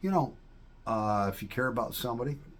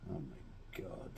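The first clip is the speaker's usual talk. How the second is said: breathless whispered dismay, not quite serious; imitated whisper; 4 semitones lower, 15 dB quieter, slower than usual. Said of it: "being like".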